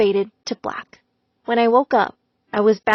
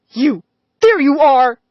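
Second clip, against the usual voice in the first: louder furious yell; screaming; 3 semitones higher, 7 dB louder, slower than usual. A narrator's reading. Mister Davis bursting in on his wife, louder furious yell. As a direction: yell at her the moment you spot her. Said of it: "he screamed at Missus Davis".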